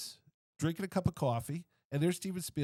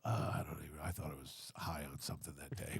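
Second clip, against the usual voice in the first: lower, soft, half-whispered; hushed imagined reply; 10 semitones lower, 4 dB quieter than usual. Pretend. Imagined Harrison Ford, not remembering him, in a hushed voice. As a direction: mutter it like a fuzzy memory. He might go.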